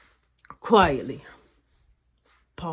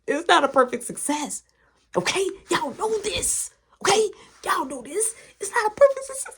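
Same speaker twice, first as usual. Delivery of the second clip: insistent; 9 semitones higher than usual. Real, not acted. Animated address to audience.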